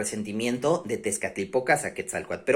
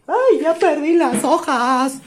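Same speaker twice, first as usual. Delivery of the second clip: dismayed cry; 12 semitones higher than usual. Real, not acted. Teacher loses her papers mid-class.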